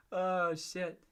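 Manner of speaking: usual speaking voice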